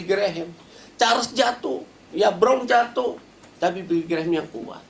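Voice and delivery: high, urgent preacher's exhortation; fiery preaching. Acted. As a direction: preach it at the congregation with fire. Preacher recounts at full force.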